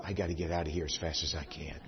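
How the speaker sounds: muttered escape plan to himself; quiet inner thought. Retold or imitated. Preacher recalling his urge to flee, hushed. Sneaking away embarrassed.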